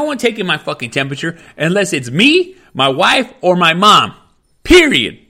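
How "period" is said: emphatic shout. Real, not acted. Ranting about temperature checks.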